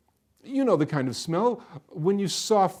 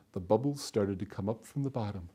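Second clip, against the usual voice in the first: usual speaking voice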